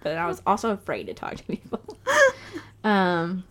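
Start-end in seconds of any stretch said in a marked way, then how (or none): 1.9-2.8 nervous laugh. Real, not acted. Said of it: "All this laughing is nervous laughter".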